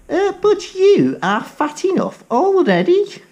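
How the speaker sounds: a little rat, nervous and small; high timid rat; frightened rat. Acted. Rat pleads with cat.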